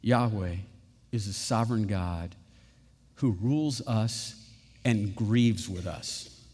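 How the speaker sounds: hushed solemn benediction; reverent close. Real, not acted. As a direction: lay it down gently. Closing reverent declaration.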